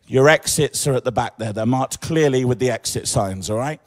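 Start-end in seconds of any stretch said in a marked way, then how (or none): none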